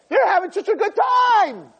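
high, loud, stretched-out cry; envious excitement. Acted. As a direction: marvel at their fun, climbing high. Voices envy of others' partying.

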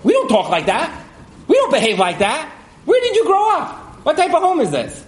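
put-on indignant parent telling off a child; scolding parent. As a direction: reprimand a small child, pitch climbing. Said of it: "as a parent, you would say".

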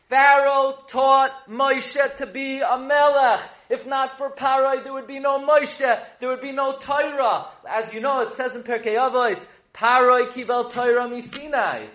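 high, loud, forceful sermon delivery; impassioned preaching. Real, not acted. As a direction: proclaim it to the whole room. Preaches the point at full force.

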